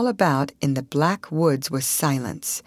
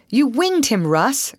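higher-pitched cry of triumph; excited cry; 7 semitones higher, 4 dB louder than usual. A narrator's reading. Paul to Russ after the shot, excited cry. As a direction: cry it out as news of a hit. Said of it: "cried Paul".

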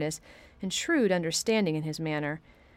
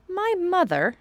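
much higher startled question; stunned boy, much higher; surprised; 11 semitones higher, 4 dB louder, slower than usual. Acted. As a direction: catch the word in surprise. Colin surprised at mother.

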